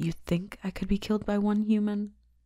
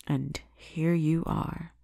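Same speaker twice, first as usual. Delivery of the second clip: slow, savoring; much slower than usual. Acted. Captive delivered to her.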